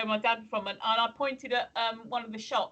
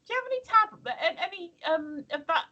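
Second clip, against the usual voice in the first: retold question; 6 semitones higher than usual. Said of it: "I said".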